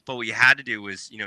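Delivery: normal talking voice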